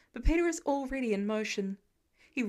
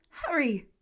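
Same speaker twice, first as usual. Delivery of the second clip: urgent; 2 semitones higher, 3 dB louder than usual. A narrator's reading. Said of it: "urgently spoken words".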